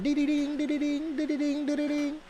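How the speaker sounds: high sung-out ding-ding alarm imitation; imitated alarm chime. Imitating the feeding alarm for the listener, high chiming ding. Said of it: "He hears the alarm".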